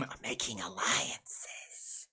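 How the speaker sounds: slow unvoiced whisper; mock sinister whisper; spooky whisper. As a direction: whisper it like a schemer. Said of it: "Trying to be all spooky".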